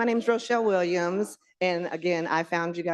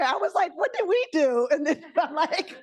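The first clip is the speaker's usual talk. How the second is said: laughing; 9 semitones higher than usual. Real, not acted. Laughing at being moved seats.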